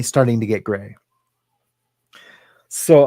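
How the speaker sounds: ordinary talk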